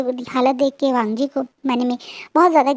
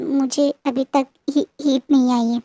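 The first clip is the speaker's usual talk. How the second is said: ordinary delivery, nothing marked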